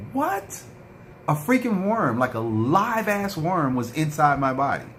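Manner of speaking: can't believe it, voice shooting up; reliving the shock of the worm; stunned outrage. Retold or imitated. Shocked, retelling worm discovery.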